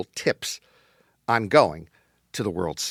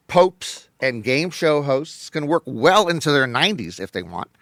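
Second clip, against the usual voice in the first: usual speaking voice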